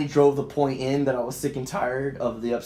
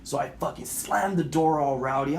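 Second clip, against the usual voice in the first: ordinary delivery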